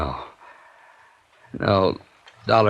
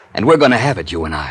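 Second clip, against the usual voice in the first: loud threat; 8 dB louder than usual. Acted. Gunman menaces the investigator.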